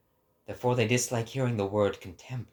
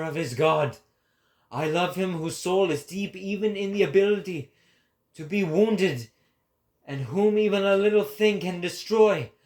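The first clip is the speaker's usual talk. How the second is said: higher, louder, grand declamation; proclaiming prophet; 8 semitones higher, 7 dB louder than usual. Acted. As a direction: preach it to the crowd like a prophet. Prophet preaching to the people.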